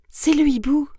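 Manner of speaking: high, loud, lifted answer; high mouse reply; little mouse voice. Acted.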